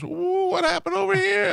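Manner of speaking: high, slow mock lady voice; rental lady. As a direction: do the fussy clerk noticing damage. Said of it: "she goes".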